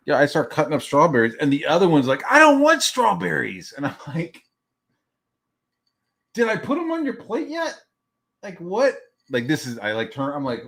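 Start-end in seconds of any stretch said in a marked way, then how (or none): 2.3-3.7 high, loud mock kid whine; hammed-up child's protest. 6.3-9.0 high-pitched incredulous questioning; hammed-up disbelief, pitched way up; exasperated parent. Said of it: "why are you yelling at me".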